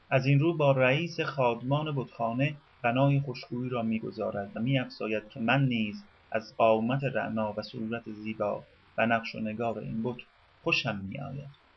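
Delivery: usual speaking voice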